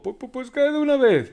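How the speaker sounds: high, loud stuttering; terrified stammer. A narrator's reading. Terrified Pedro stammers at the ghost, loud and high. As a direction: force the words out through fear. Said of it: "dice Pedro".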